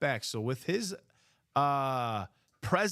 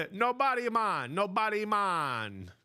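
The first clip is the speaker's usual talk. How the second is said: put-on Jamaican accent; 5 semitones higher, 3 dB louder than usual. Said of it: "doing a Jamaican accent right now".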